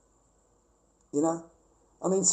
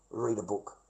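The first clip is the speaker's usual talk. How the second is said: much softer and lower; soft aside; 8 semitones lower, 12 dB quieter than usual. Real, not acted. Telling viewers how he falls asleep, softer and lower. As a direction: mutter the advice to yourself. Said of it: "It's really good if I wanna go to sleep".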